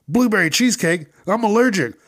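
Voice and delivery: high, loud, fast whiny mock voice; hammed-up whiny mockery of the worker. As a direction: mimic him as a whiny baby. Mocking the worker's reply.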